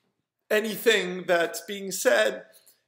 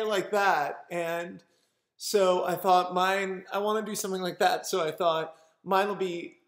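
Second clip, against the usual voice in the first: usual speaking voice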